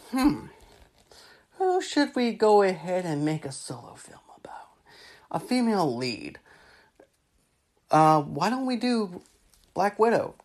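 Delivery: mock executive mulling it over, hushed; pondering. Marvel imagined mulling over a film.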